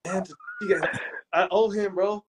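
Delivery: high-pitched, giggly joking; laughing. Real, not acted. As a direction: crack up while telling it, voice way up high. Joking about owing a friend.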